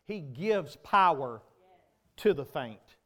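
ordinary delivery

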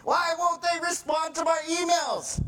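high-pitched, exasperated mock shout; frustrated outburst. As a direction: wail the complaint in hammed-up frustration. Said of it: "gets so frustrating".

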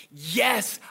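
high, loud and insistent, slowing on the last line; God's promise, loud. Acted. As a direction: proclaim each promise like a rising cry. Preacher voicing God's promise.

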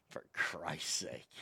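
tired father giving up on his son; exasperated dad. Retold or imitated. Dad woken with news of the pierced ear, weary low grumble. Dad hears about the earring.

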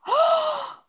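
high wailed "oh", then halting admission; imitated bawling. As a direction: act out a tearful breakdown. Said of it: "just started bawling".

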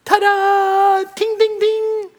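very high, loud ringing ta-da; triumphant fanfare. Acted. Preacher shows the happiness line hitting top, triumphant. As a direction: squeal it like a bell at the top.

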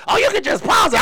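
high, dismissive mock of the baby mama. Baby mama brushing him off, high mock voice. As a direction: toss it off in a high voice. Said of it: "My baby mama be like".

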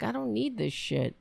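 ordinary talk, nothing marked

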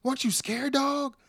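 high-pitched comic goading; R-Truth. Retold or imitated. Acting Truth goading Waller about casket.

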